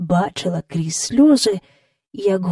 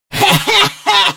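put-on high, hoarse old man's cackle; great-grandfather's rasping laughter; 13 semitones higher, 4 dB louder than usual. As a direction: cackle hoarsely like a gloating elder. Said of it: "хрипкий сміх прадіда".

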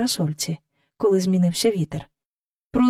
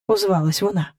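usual speaking voice

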